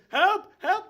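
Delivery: loud high cry for help; guard screaming help; guard yelling for help. Acted. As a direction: shout for backup at the top of your voice. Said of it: "And as he goes".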